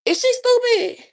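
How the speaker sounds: high, loud disbelief; can't believe what she heard; incredulous. Real, not acted. Reacting to the girl's claim.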